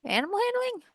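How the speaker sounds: baffled question. Real, not acted. Baffled at missing skill.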